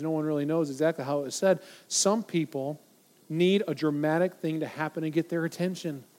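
usual speaking voice

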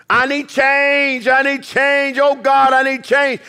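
high, urgent plea to God, drawn out; crying out. Acted. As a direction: cry out desperately for change. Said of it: "we're crying out for change".

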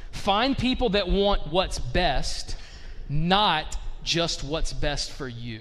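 slow, each word weighed, stress on "not"; slow emphasis. Shown as a demonstration. Preacher driving home the difference, slow and stressed. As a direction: lean on "not just" slowly. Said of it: "Do you understand the difference".

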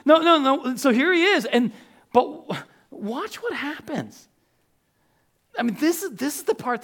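high, loud, eager; can't wait to show this; excited build-up. Real, not acted. Preacher hyping the congregation, high and loud.

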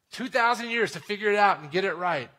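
normal talking voice

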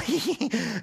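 high, short villain's titter; high mock laugh in character; mocking snicker. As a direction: cap the taunt with a put-on snicker. Tobiah's mocking laugh, acted.

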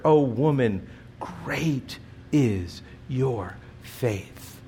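slow tender praise. Retold or imitated. Jesus praising woman's faith.

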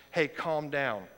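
ordinary talk, nothing marked

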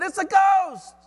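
high, loud shout of terror; full-voiced, high-pitched panic; fearful cry. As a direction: cry out in acted fright. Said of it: "they cried out in fear".

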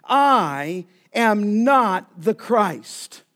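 very slow, each word weighted; very strong denial. Retold or imitated. John denying to the delegation, slow emphatic. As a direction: deny it firmly, word by word. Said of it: "very strongly".

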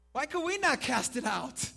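loud, high-pitched puzzled demand; baffled question. Acted. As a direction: ask Jesus in frustrated bewilderment. Disciples baffled, question Jesus.

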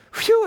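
high, sharp phew; sudden relieved phew; phew of relief. Retold or imitated. Relief as the glider climbs.

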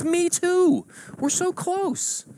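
loud, high squeal of kinship, then drawn out; hammed-up ecstatic bonding; overjoyed agreement. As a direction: squeal it, thrilled to match. Joke: growing delight at match.

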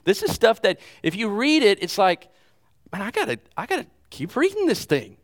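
excited thought. Retold or imitated. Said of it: "I thought".

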